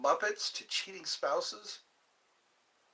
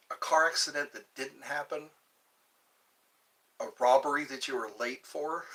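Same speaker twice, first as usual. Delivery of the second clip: normal talking voice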